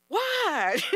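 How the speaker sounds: high startled yelp of a question; shocked what. Retold or imitated. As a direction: blurt it out in alarm. Guest shocked at dog snatched.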